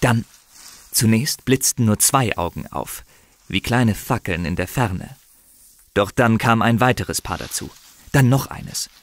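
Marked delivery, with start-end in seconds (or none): none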